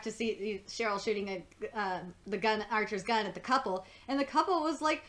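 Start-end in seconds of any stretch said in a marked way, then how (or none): none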